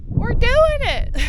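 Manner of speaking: high, loud, slow exultant cheer; proud summit cheer; triumphant cheer. Real, not acted. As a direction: shout it out in triumph. Celebrating the climb.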